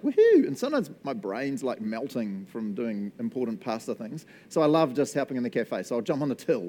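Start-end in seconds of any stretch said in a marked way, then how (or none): 0.0-0.5 high, loud cheer; excited woohoo. Retold or imitated. Pastor cheering as the cafe fills, high and loud. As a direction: whoop at the busy cafe. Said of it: "I'm always like".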